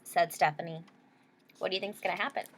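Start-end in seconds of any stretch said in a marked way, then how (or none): none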